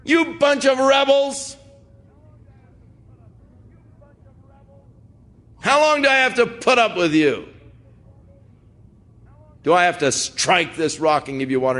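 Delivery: high, exasperated yell at the people; yelling, calling names. Acted. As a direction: berate them like a fed-up leader. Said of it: "He starts yelling at them".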